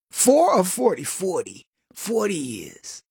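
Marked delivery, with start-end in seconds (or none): 1.0-3.0 low, soft, slow smoker rasp; smoker lady voice. Retold or imitated. Edna answers Kevin in a deep, slow smoker voice. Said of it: "if a lady talks like this".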